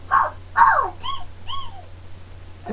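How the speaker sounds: very high squeaky mouse giggle. Shown as a demonstration. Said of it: "we do it with our mouse voice this time".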